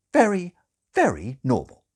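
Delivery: first Very high and loud, falling; emphatic repetition. Acted. Nurse presses father, stressing Very. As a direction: hammer home very normal.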